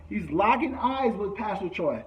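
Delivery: usual speaking voice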